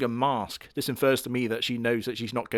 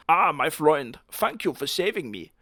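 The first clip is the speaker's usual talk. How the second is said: hammed-up German accent, higher; mock German; 4 semitones higher than usual. Hitler thanking his saviour in a mock German accent. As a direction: greet your friend in a German accent.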